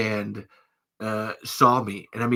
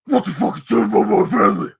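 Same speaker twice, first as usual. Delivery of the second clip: loud, high slurred confrontation; garbled angry impression; 8 semitones higher, 7 dB louder than usual. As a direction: get in someone's face, words mashed together. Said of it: "more clear than that, but that's the impression".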